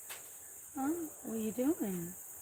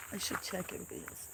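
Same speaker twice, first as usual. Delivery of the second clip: fast soft whisper; quick whisper; 8 dB quieter, much faster than usual. Real, not acted. Owner checking the other cats, quick whisper. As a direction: hurry the words out in a hush.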